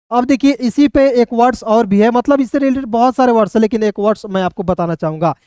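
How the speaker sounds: ordinary talk, nothing marked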